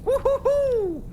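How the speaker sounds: gleeful whoop. Acted. Crewmate cheering the freshly cleaned ship, gleeful whoop. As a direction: crow with joy over a job well done.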